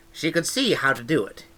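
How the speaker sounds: ordinary delivery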